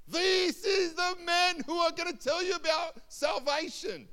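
very high, loud, sustained cry; hammered-up public proclamation on one high note; slave girl proclaiming. Acted. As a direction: holler it relentlessly after them. Slave girl shouting for days.

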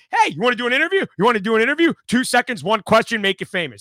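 high, eager street-interviewer pitch; hailing passersby for an interview; calling out to strangers. Retold or imitated. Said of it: "but I'm like".

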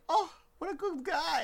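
high, slow, swooning; gushing admiration. Retold or imitated. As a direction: swoon over him. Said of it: "I was like".

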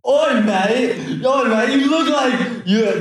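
high-pitched cartoon British heckler; mock British accent. Retelling British tourists hailing his dad, high mock British accent. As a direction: imitate excited Brits in a high voice. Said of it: "they were talking really British".

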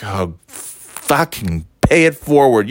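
slow, drawn-out sarcastic repeat; scornful echo. Retold or imitated. Throwing cashier's instruction back, slow scornful echo. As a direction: stretch the swear in disgust.

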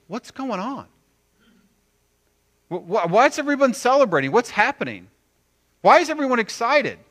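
high, incredulous questioning; baffled older son. Acted. Older son arrives at the party, baffled and high-pitched. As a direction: stammer into loud, high questions.

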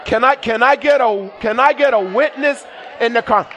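loud shouted call to the congregation; rally the church for a witness; preaching call-out. Acted. Preacher calling for response.

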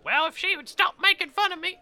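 high, loud indignant character voice; teased little guy. Acted. Little guy complaining about his teasing companion, high and loud. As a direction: pitch it way up and protest. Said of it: "she sorta smacks him".

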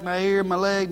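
ordinary talk

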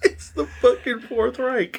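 laughing. Real, not acted. Friend cracking up at his own joke, laughing. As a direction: laugh it out as you say it.

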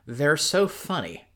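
high, drawn-out amused gush; mock-jerk Lucy. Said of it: "is again, like, a real jerk and is like".

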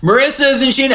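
high-pitched acted holler; calling over noise. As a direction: repeat it high to cut through the din. Seth repeating over the blender.

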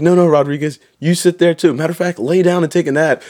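high-pitched sarcastic coddling; mock-solicitous trooper. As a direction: imitate a trooper pretending to be kind. Trooper sarcastically coddles sleeping recruit.

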